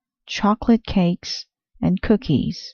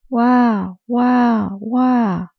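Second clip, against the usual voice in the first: put-on baby crying wah; 6 semitones higher, much slower than usual. A narrator's reading. Narrator wailing as the crying baby. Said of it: "she cried".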